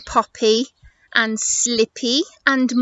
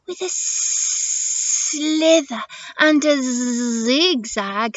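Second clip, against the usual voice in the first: drawn-out hissing s and buzzing z; slippery snake; 2 semitones higher, slower than usual. Acted. Snake answering how she'll cross, slow hissing voice. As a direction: stretch the sibilants slowly like a snake.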